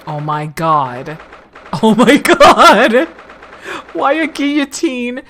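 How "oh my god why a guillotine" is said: shocked outburst. Real, not acted. Player reacts to the prison office, loudly shocked. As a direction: draw it out loud and high in shock.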